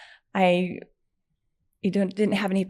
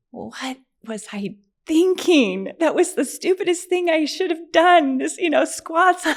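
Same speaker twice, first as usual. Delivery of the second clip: high, loud mock self-reproach; mad at herself; 10 semitones higher, 6 dB louder than usual. Injured woman scolds herself over squats, loud retold exasperation. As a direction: berate yourself in hammed-up exasperation. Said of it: "I was so mad at myself".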